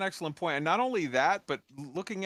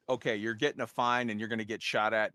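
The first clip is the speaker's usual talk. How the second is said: ordinary talk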